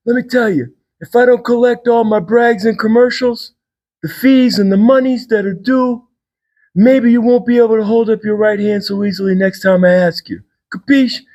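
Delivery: mock mobster threat, voice held high; mob boss. Sergeant at arms shaking down Rotary members, mock mob boss. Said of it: "impersonation of a mob boss".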